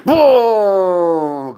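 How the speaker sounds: loud, high delighted oh; delighted cry. Real, not acted. Owner gushing at his cat, loud delighted cry. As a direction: gush at the cat.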